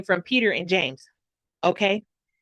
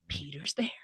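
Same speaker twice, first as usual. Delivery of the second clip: much softer, hushed shock; whispered disbelief; 6 semitones lower, 14 dB quieter than usual. Retold or imitated. James on the phone learning Peter is there, hushed. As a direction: breathe it in disbelief.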